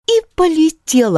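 ordinary delivery, nothing marked

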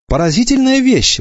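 a high indignant mother's exclamation; mock outrage at a boy afraid of a haircut. A narrator's reading. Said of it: "возмутилась мама". (возмущённо, высоко)

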